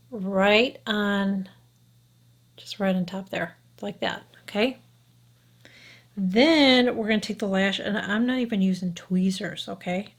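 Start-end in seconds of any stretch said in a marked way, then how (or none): none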